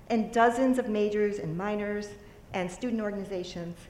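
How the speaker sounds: usual speaking voice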